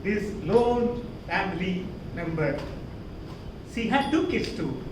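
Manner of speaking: normal talking voice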